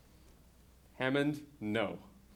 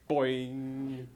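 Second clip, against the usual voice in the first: plain reading voice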